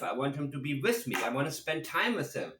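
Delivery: normal talking voice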